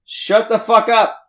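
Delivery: high, exasperated shouting at friends; angry yelling. Acted. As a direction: tell them to shut up, fed up. Character yelling at others to stop.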